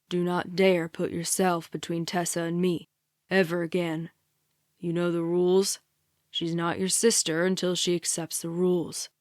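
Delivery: low, stern rebuke; growling captor. A narrator's reading. Captor scolds the sister for stepping between, low growl. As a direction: keep it deep and controlled, laying down the law. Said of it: "he growls".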